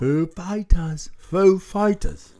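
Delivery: slow, stretched celebrity impression; Christopher Walken. Host attempting the band introduction, slow Walken impression. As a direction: draw out each word, oddly emphasized. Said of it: "I can't do Christopher Walken".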